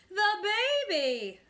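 high, loud, alarmed yell. A narrator's reading. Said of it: "I yelled louder than anyone".

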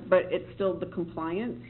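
normal talking voice

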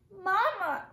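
high, loud cry; girl cries out to Mama after soldiers leave. A narrator's reading. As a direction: call out to her mother in alarm.

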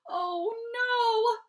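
high, wailing maternal lament; moaning. A narrator's reading. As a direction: wail it, stretching the vowels. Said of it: "My mother moaned".

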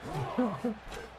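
soft high giggle; a quiet squeaky laugh. Real, not acted. Streamer giggling at chat.

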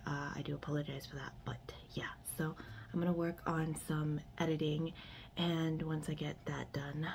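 hushed unvoiced whisper. Real, not acted. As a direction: keep it to a whisper. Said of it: "why I'm whispering".

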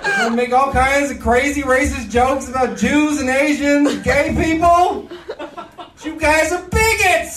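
high, shouty hammed-up rant; a high wailing parody of a protester; mock activist rant. Parodying Greta's crying rant.

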